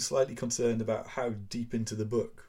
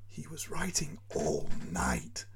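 slow, unvoiced frightened whisper; 4 semitones higher, slower than usual. A narrator's reading. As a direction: hush the house so he sleeps. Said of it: "whispers his wife".

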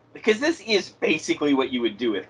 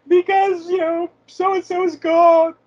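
put-on child voice; 6 dB louder, slower than usual. Imitates a grieving child.

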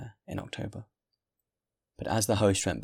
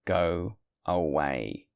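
very slow, weighty order; startling, menacing tone; 3 semitones lower, much slower than usual. A narrator's reading. Host bends to the guest's ear, slow and menacing. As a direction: draw both words right out as a warning. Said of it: "in a tone which made him start".

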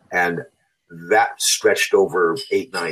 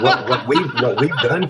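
laughing through the words, higher and louder; 6 semitones higher, 4 dB louder than usual. Real, not acted. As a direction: still laughing as he starts to speak. Laughing while starting to reply.

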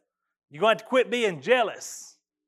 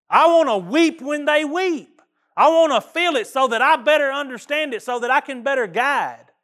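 earnest prayer; 5 semitones higher, 4 dB louder than usual. Retold or imitated. Minister praying to God for compassion, earnest and pleading. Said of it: "And I began to pray".

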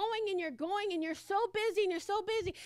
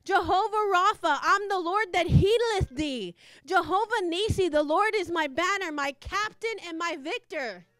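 louder, fervent declaration of each name; declaring God's names; 5 dB louder than usual. Real, not acted. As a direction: call out each name with conviction. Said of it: "And I started saying".

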